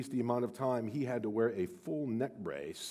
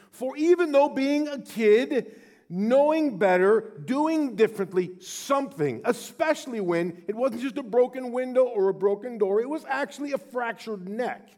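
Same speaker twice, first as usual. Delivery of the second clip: full-voiced pulpit intensity; rising preaching; 10 semitones higher, 7 dB louder than usual. Real, not acted.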